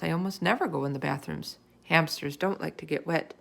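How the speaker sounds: ordinary delivery, nothing marked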